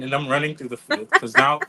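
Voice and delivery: ordinary talk, nothing marked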